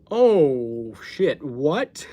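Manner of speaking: loud, shocked oh shit what. Retold or imitated. Reacting to Craig's Jesus reply, loud shocked outburst. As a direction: blurt it out in shock.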